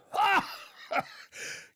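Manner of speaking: high breathy burst of laughter; loud laugh. A narrator's reading. Old man laughing at Mark's answer, loud and high. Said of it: "Janoda lachte laut auf".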